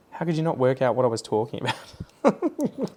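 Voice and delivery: exasperated complaint climbing high at the end; mock exasperation.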